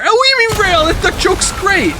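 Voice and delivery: high, fast squeaky mock outrage; hammed-up high-pitched indignation; mock outraged chatter. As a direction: play an indignant chatter in a shrill falsetto. Mock outraged reply to chat.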